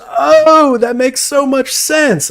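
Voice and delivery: high, slow, dopey realization; mock dawning kid. Imitating the kid's realization, high and slow. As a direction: stretch it out like a slow kid finally getting it. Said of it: "And he goes".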